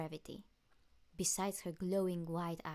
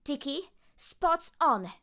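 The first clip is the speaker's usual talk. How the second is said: slow, high, loud rallying call; transformation cry; 9 semitones higher, 13 dB louder, slower than usual. Acted. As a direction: call out the transformation with full power.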